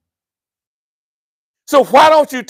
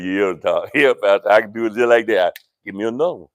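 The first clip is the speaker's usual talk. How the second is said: lightly voiced cool guy; 12 semitones lower, faster than usual. Man imitated answering pastor casually.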